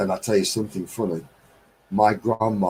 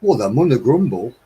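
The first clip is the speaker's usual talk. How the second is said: "munna grumble", louder, in a broad Midlands accent; Midlands dialect; 5 dB louder than usual. Shown as a demonstration. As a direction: say "munna" like at home. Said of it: "where I come from, they say".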